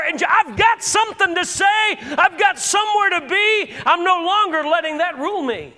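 rising preacher's shout, loud and high; fiery pulpit preaching; preaching at full force. Real, not acted. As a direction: build the sermon to a roaring climax.

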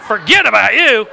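loud, joking blurt; mock dismissive shout. Pastor jokingly dismissing the congregation, mock shout.

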